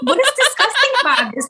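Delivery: high, laughing disbelief; replying to Iranian commenters, laughing in disbelief; incredulous laughing retort. Retold or imitated.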